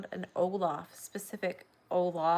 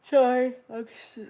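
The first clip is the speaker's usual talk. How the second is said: words swallowed by a loud yawn; real yawn breaking the words; 5 semitones higher, 11 dB louder than usual. Real, not acted. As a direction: apologize mid-yawn. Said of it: "I'm yawning".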